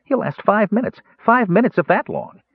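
very high, huffy mouse voice; indignant squeak. Acted. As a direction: scoff at the idea in a tiny squeak. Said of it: "Tass thought indignantly".

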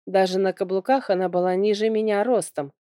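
ordinary delivery

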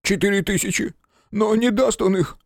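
high, near-shouting panic; almost yell it in panic. A narrator's reading. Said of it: "едва не заорал Федышин".

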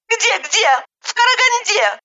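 very high, mocking comeback; curt retort. A narrator's reading. Narrator brushing off a nosy neighbor, curt retort. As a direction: cut off the question with a high-pitched retort. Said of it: "отрезала я".

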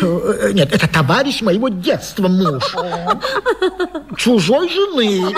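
flustered laugh through the words; embarrassed man chuckling at his slip; nervous laugh. Acted. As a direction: laugh it off, caught out. Embarrassed husband explains himself.

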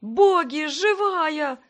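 can't believe she's alive; overjoyed exclaiming. Acted. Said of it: "Как ненормальный всё повторял и повторял". (как ненормальный, громко от радости)

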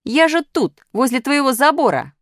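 very high, loud call; indignant shout. A narrator's reading. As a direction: shout over the fence in protest. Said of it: "крикнула Пятёрка".

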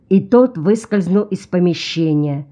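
ordinary delivery

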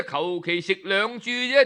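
plain reading voice

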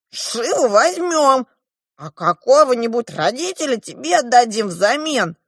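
high, insistent dwarf voice; bargaining dwarf. A narrator's reading. The dwarf offers the girl a swap, bargaining. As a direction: pitch the deal high and briskly emphatic.

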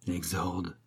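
low, soft aside; murmured. A narrator's reading. Abbot's aside on Pitou's preamble, murmured. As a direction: drop it under your breath. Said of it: "murmura l'abbé".

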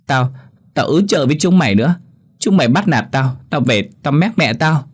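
a touch of crying tantrum, whining; little girl whining she'll tell mum; whiny crying child. Acted. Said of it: "ăn vạ vừa khóc vừa nói".

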